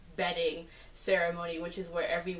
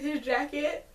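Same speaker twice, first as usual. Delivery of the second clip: normal talking voice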